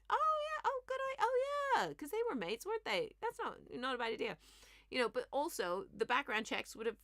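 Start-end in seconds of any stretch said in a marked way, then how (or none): 0.1-1.9 very high-pitched, exaggerated acted agreement; loud, high mock approval. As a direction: squeal the oh-yeahs in a silly high voice. Imagined easy-going public reaction, acted out.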